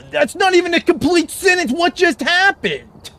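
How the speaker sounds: high, loud disbelief, slowing on the second question; outraged squeal at the poll; shocked outrage. Real, not acted.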